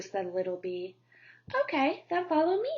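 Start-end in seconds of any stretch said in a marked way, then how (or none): 1.6-2.7 quick, higher birdlike chirp; chirpy cardinal. Acted. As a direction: tweet it brightly and fast like a little bird. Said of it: "he tweeted".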